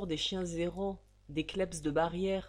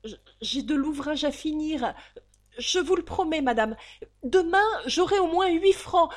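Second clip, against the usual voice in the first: much higher and louder, stammering; frantic pleading; 9 semitones higher, 11 dB louder than usual. Acted. Valentine frantically promising the landlady payment.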